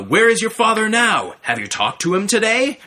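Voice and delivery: normal talking voice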